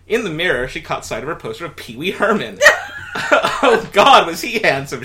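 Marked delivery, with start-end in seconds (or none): 2.6-3.1 real squealing burst of laughter; helpless high-pitched laugh at the story; high shriek of laughter. Real, not acted. Cracks up reading. 3.2-5.0 louder, higher swoon, cracking up; gushing over a crush while laughing; swooning through laughter. Real, not acted. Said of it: "she thought".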